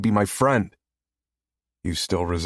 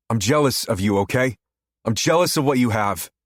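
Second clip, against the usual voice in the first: usual speaking voice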